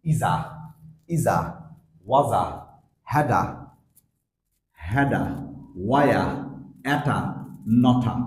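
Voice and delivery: weak forms ইজা, ওয়াজা, হ্যাডা each with a short ending a; weak form drill. Shown as a demonstration. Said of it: "এগুলা উচ্চারণ করবো কি করে". (দুর্বল রূপ ড্রিল করে উচ্চারণ)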